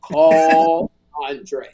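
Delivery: a laughing attempt at God's voice; divine voice. God's voice telling Sherry to call Andre, breaking into a laugh. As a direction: intone it solemnly but crack up mid-line. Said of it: "but the voice said".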